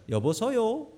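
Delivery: high, sing-song Gangwon accent; Gangwon dialect. Shown as a demonstration. Pastor performing a high sing-song Gangwon dialect to amuse the congregation. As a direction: call it out in a high Gangwon lilt. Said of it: "강원도 버전은 이렇답니다".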